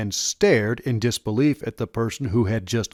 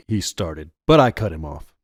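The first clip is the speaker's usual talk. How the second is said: usual speaking voice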